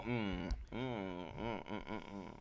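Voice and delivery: soft mmm sinking away; imitating a blissed-out eater; contented food moans. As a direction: hum with pleasure, fading out. Imitating blissful eating noises.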